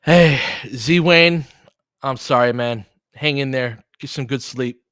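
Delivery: usual speaking voice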